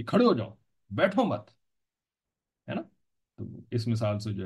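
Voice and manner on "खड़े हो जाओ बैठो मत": "खड़े हो जाओ", short break, "बैठो मत", both high; demonstrated command. Shown as a demonstration. Teacher spelling out the resulting order, high demonstrated command. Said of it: "तो फिर क्या हुक्म बन गया".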